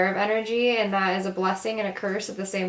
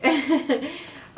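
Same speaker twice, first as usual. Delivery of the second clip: short high giggle; 7 semitones higher than usual. Real, not acted. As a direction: burst into a brief, high giggle. Giggles after calling herself witchy.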